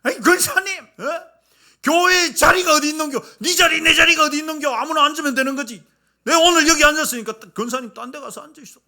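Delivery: high, loud, fast dialect retort; mock angry outburst. Deacon snapping at deaconess over the seat, angry. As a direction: bark back at the old lady. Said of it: "성질을 버럭 내면서".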